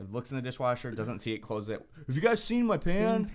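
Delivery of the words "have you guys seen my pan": higher mock roommate voice, more insistent; mimicking roommate. Roommate asking housemates again about his pan, higher mock voice.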